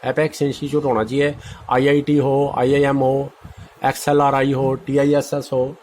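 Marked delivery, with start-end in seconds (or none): none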